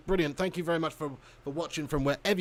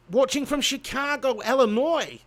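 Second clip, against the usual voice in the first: mock Chicago accent, higher and louder; 6 semitones higher, 6 dB louder than usual. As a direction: announce it in an attempted Chicago accent. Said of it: "How do they speak?".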